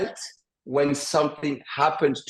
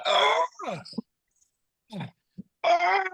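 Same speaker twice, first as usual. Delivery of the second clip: compressed breathy scream, then much higher; scream without screaming. Shown as a demonstration. Therapist shows how to let anger out, held-back scream. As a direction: push the cry out from the gut without full voice. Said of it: "I'm gonna scream without screaming".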